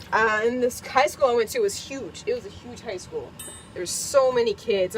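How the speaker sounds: usual speaking voice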